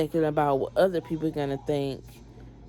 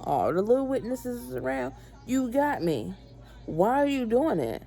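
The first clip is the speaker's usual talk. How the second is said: high-pitched exasperated protest, acted out; 6 semitones higher than usual. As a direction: act out arguing back at a cop, higher. Pleading exasperatedly with arresting officer.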